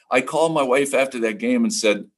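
usual speaking voice

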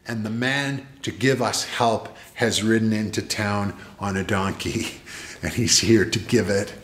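usual speaking voice

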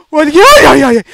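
loud high panicked ay ay; frightened cries. Real, not acted. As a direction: cry out in real fright. Scared by game monster.

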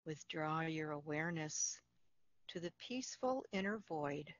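calm, unhurried meditation voice with long pauses; guided meditation. Acted. Facilitator guiding listeners through Yoga Nidra, calm guided meditation.

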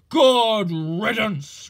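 loud, slow, heavy grumble in a big engine voice; grumbled. A narrator's reading. Gordon grumbling at Duck after City of Truro leaves, loud and slow. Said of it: "grumbled Gordon".

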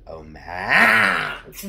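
loud mock Grinch groan; imitating Grinch.

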